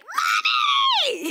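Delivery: put-on very high, loud child's cry of Mommy; excited child shout. Little kids greeting mom home from a trip, a high excited shout. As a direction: squeal it like a little kid at the door. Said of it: "it would be like".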